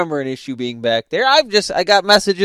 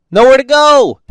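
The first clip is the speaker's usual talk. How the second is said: louder and higher, fed up; exasperated outburst; 8 semitones higher, 7 dB louder than usual. Real, not acted. Driver venting about being boxed in, exasperated shout. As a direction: shout "Nowhere to go!" in disbelief.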